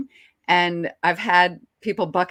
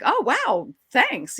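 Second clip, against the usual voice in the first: high, pleased reaction, retold; excited; 6 semitones higher, slower than usual. Actress thanking the director for a bigger part, excited. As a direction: imitate delighted gratitude, pitched up. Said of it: "like, excited".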